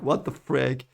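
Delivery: ordinary talk, nothing marked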